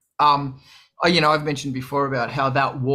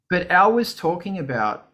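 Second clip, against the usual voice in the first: normal talking voice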